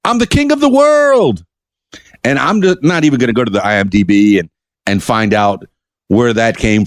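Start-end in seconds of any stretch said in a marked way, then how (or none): 0.1-1.4 Leo. Acted. Host quotes Leo on the front of the Titanic, high mock-triumphant cry. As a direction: sing it out high and stretch "world". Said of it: "and says".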